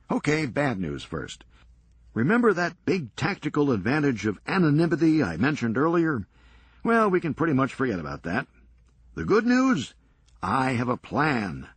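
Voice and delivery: brisk, sardonic comeback; wry Serge. Acted. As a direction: toss it off dryly, quick. Serge lays out plan.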